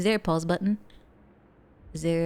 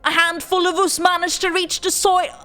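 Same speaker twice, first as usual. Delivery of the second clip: hammed-up British accent, higher and louder; fake British accent; 8 semitones higher, 4 dB louder than usual. Streamer reading the game's story in a fake British accent. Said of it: "worst fake British accent in the world".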